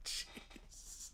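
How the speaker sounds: breathy whisper after a laugh; stunned under-breath; soft disbelief. Real, not acted. Stunned aside.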